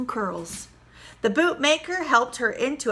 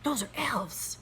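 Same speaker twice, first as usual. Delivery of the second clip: softer, unvoiced whisper; hissed; 9 dB quieter, slower than usual. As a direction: hush him from the closet. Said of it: "hissed his wife".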